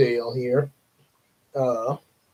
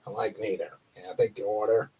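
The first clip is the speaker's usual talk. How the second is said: low, soft and bored cashier; low bored cartoon cashier; low grumpy voice; 6 semitones lower, 8 dB quieter, faster than usual. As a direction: drop it low and unimpressed.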